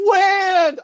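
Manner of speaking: high, loud cry imitating someone freed; mock joyful shout. Friends get out of the van, mock shout of relief.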